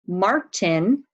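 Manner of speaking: words of the sentence drawn out very slowly; slow dictation. Shown as a demonstration. Teacher slowly dictates a sentence to spellers. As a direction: dictate each word slowly. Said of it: "This sentence has five words".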